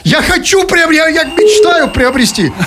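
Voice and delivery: high, slow, hammed-up pleading; mock pleading. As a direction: plead with the robot, drawn out. Begging the phone robot.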